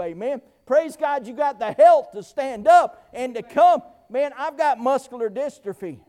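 normal talking voice

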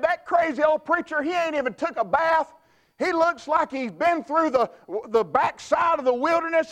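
a hint of gossiping critics; mocking crowd. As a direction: sneer at the dirty preacher. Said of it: "And they said".

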